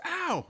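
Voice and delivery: sudden high yelp of "Ow!"; mock yelp of pain. Streamer acting out the pain of plucking, a high yelp. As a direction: squeal it like a plucked hair hurts. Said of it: "Like, I goes".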